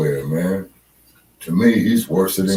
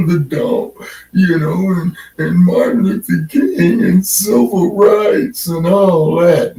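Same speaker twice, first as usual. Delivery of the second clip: mock crying preacher; 11 semitones higher, 5 dB louder than usual. Mocking Van Jones crying speech.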